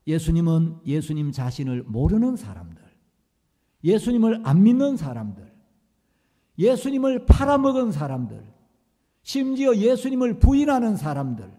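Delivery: loud, rising sermon cadence; fervent preaching. Acted. Preacher pressing grace on the congregation, fervent rising sermon. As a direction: preach it with building passion.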